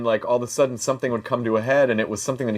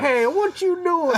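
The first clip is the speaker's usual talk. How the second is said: very high, drawn-out character voice; new comic character; slower than usual. Acted. Comic greeting his friends as a new character, high silly voice. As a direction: stretch it out way up high. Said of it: "I'm this character now".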